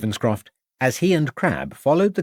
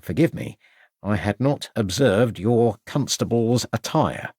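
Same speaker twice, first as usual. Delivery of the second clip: ordinary delivery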